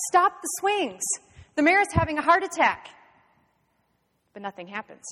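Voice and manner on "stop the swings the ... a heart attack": high, loud alarm call; urgent shout. A narrator's reading. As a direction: shout for help in an emergency. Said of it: "George shouts".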